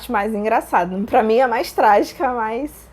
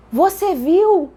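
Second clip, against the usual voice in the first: high, loud, drawn-out question; re-enacted thrilled disbelief; retold amazement; 7 semitones higher, 6 dB louder, much slower than usual. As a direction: ask it in stretched-out astonishment. Re-enacts astonished reply to Bruno.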